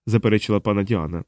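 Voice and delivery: ordinary delivery, nothing marked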